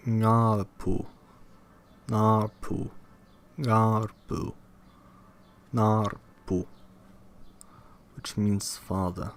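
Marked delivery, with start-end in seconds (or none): 0.1-6.7 "ngarpu" with its first syllable stressed. Shown as a demonstration. Reader practises Australian language examples, stressing the first syllable of "ngarpu". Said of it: "the first syllable of word is always stressed".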